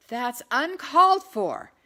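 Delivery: loud, slow outraged protest; indignant cry. Acted. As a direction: cry out at the insult. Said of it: "Anne cried".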